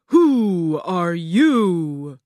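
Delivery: loud, drawn-out demand; booming Oz. A narrator's reading. Oz questions the girl, booming and slow. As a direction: boom each word out very slowly.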